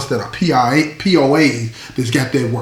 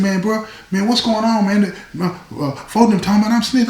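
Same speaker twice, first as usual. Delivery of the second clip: raised, worried inmate voice; inmate voiced higher; 7 semitones higher than usual. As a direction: play the nervous guy asking what's up. Worried inmate voiced in retold story.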